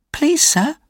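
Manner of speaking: put-on very high little-boy plea; frightened hungry boy. A narrator's reading. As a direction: plead timidly in a high child's voice. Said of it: "replied Oliver".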